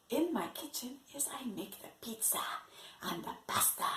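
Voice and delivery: fast soft unvoiced whisper. Teacher whispering about cooking to the class. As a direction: whisper it quickly like a secret.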